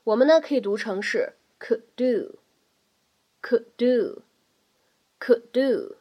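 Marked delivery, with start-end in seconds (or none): 1.6-5.9 could do with the d not released; 失去爆破; lost plosion. Shown as a demonstration. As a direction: glide could into do. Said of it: "失去爆破的现象".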